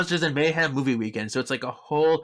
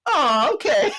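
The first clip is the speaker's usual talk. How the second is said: very high, louder melting voice; docile daddy; 6 dB louder, slower than usual. Retold or imitated. Leo instantly softened.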